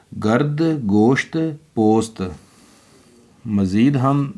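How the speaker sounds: normal talking voice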